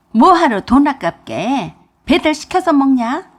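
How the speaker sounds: a mother scolding her son about delivery food; scolding outburst. Acted. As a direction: scold him for wasting money, loud.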